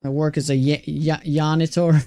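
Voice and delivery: "janitor" said as "yanitor"; Swedish J-as-Y. Retold or imitated. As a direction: stumble on a Y, then say "janitor" starting with Y. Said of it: "He pronounces J's like Y's".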